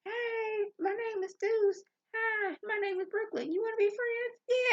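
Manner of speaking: put-on very high child's voice; little kid voice. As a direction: sound like small children making friends. Voicing little kids meeting.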